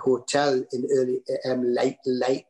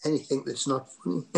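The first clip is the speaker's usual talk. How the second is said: ordinary delivery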